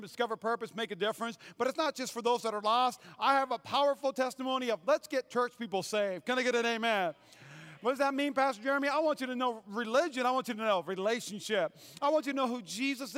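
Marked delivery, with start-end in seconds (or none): none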